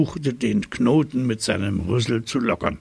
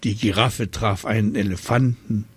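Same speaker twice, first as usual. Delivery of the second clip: usual speaking voice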